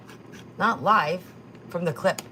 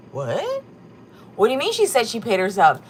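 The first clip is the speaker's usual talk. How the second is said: loud, fast, incredulous question; can't believe what you just heard; stunned and louder; shocked disbelief; 3 semitones higher, 8 dB louder than usual. Real, not acted. Shocked reaction to a claim.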